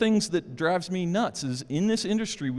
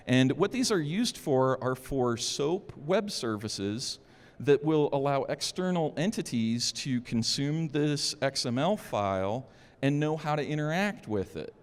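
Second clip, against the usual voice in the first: normal talking voice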